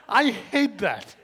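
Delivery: loud, high, drawn-out complaint; groan-like protest, played for laughs; comic exasperation. Real, not acted. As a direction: stretch it out in amused exasperation. Comic complaint about walkers' false promise.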